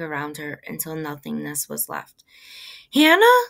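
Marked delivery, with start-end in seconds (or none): 2.9-3.4 loud, high cry of the name, rising at the end; shouted question, pitched way up; alarmed shout. A narrator's reading. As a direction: call out in sudden alarm. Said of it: "Ma shouted".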